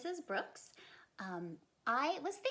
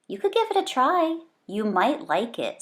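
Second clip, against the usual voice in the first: mom's voice; 3 semitones higher, 15 dB louder than usual. Acted. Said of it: "said Bean's mom".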